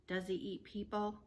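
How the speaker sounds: ordinary delivery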